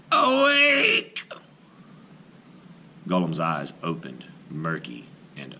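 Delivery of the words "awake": high, loud ringing command; commanding call. A narrator's reading. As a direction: summon the golem to life with force. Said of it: "commanded the rabbi".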